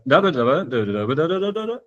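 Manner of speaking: da-da syllables climbing high at the end; speech melody. Shown as a demonstration. Showing that speech has melodies, wordless intonation on da. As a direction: mimic a sentence's rising tune in das. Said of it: "I actually speak with melodies".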